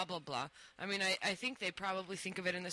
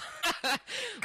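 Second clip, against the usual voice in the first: laughing; 13 semitones higher than usual. Real, not acted. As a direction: burst of real laughter. Laughs at own calculation.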